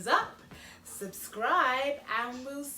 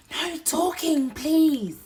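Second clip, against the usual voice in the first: librarian; 5 semitones higher, 11 dB louder, slower than usual. Acted. Librarian scolding child.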